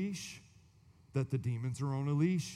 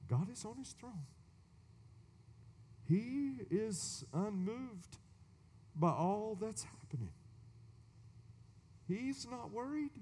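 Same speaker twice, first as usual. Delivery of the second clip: softer, higher, unhurried assurance; calm reassurance; 6 semitones higher, 7 dB quieter than usual. Real, not acted. Preacher comforting congregation, soft and high. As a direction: reassure them gently that God reigns.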